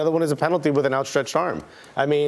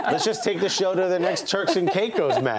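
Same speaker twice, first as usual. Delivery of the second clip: laughing; 6 semitones higher than usual. Real, not acted. Giggling joke about a road trip.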